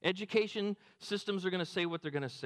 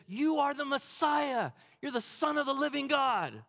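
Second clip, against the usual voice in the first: excited Peter; 8 semitones higher, 4 dB louder than usual. Acted. Peter blurts out declaration.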